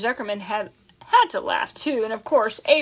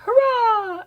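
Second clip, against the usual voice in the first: crowd cheer; 12 semitones higher than usual. A narrator's reading. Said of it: "The crowd cheered".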